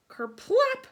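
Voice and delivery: high, loud splashy kerplop; splash sound effect. A narrator's reading.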